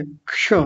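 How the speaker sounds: क्ष with a barely-there क and a long breathy ष; क्ष said softly, stressing the ष; half-voiced क्ष. Shown as a demonstration. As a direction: clip the क, let the ष hiss out. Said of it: "ध्यान दीजिए".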